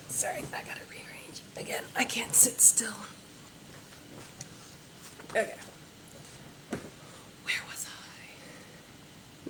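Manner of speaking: hushed, whispery muttering to oneself; muttered aside. Real, not acted. Narrator losing their place mid-recording, muttered aside. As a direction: say it under your breath, barely voiced. Muttering to self between takes.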